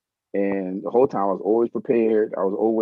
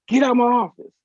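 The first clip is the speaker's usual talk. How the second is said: mock yell; 14 semitones higher, 5 dB louder than usual. Mentor throws student out.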